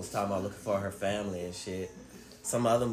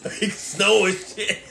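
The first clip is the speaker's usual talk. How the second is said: laughing; 8 semitones higher, 9 dB louder, much slower than usual. Retold or imitated. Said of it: "She be like".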